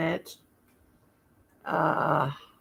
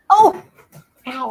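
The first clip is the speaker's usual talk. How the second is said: sudden pain; 7 semitones higher, 11 dB louder than usual. Real, not acted. Scratched by the dog.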